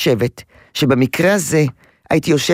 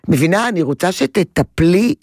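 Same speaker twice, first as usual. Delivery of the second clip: ordinary talk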